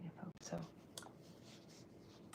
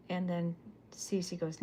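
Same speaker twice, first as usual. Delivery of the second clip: ordinary delivery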